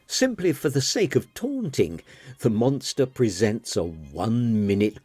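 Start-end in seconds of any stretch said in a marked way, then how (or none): none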